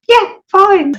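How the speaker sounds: high, loud, instant yes; eager acceptance. Retold or imitated. She grabs the owner's payment offer, eagerly. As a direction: leap at the deal, high and loud.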